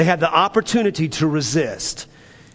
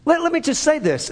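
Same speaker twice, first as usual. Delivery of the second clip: rising preaching fervor; 8 semitones higher than usual. Acted. Pastor pressing the point to the congregation, climbing in intensity. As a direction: pitch climbs high as the point builds.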